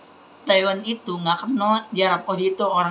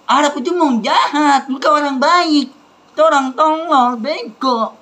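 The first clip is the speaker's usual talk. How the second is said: loud and higher, shouting the insults; angry rant; 6 semitones higher, 6 dB louder than usual. Real, not acted. Child ranting to viewers about Saudi Arabia, loud angry shout.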